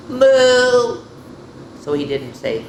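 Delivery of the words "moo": high, loud, drawn-out moo; long mooing answer; cow moo. A narrator's reading. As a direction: imitate a calf with a long moo. Baby cow's answer in story.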